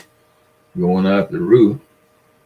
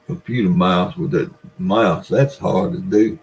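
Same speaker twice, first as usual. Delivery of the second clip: ordinary talk, nothing marked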